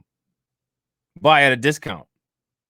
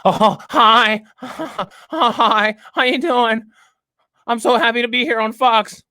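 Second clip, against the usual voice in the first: high, sugary mock-polite guest; hammed-up grateful TV guest voice; 9 semitones higher, slower than usual. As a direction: mimic a fawning guest. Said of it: "to just be sitting there".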